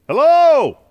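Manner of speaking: loud high call into the empty woods; a loud, echoing hello to nobody. Real, not acted. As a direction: shout it out to see if anyone answers. Calling out in empty forest.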